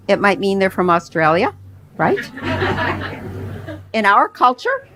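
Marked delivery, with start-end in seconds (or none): 2.2-3.9 light laugh. Real, not acted. Laughs after Australia joke.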